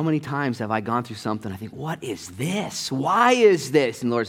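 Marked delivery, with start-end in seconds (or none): 1.7-4.0 slow, drawn-out bewildered questions; acted slow-motion exasperation at a trial; exasperated questioning. Acting out questioning God in trials.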